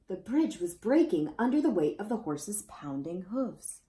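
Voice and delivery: ordinary delivery, nothing marked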